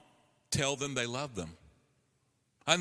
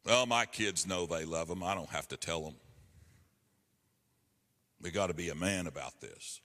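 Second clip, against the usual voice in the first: lower, dismissive imitation of a tough dad; mock the dad who won't say it, deeper; macho dad; 9 semitones lower than usual. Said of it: "don't buy the lie".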